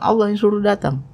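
lightly acted retort to the wife; retold reply. Man answering his wife, lightly acted.

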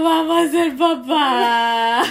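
loud, slow, crying wail; weeping with overwhelmed joy; tearful cry. Retold or imitated. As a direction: sob the words out. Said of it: "solo lloraba".

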